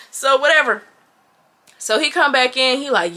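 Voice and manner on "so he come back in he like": ordinary delivery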